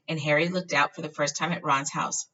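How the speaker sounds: usual speaking voice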